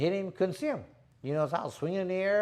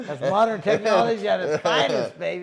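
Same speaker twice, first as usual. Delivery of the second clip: laughing; 2 semitones higher, 8 dB louder than usual. Real, not acted. Joking about his eye surgery.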